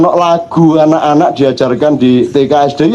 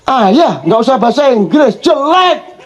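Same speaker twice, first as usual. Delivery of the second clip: much higher, laughing mock child; child scolding father; 7 semitones higher than usual. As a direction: whine at dad to stop the English.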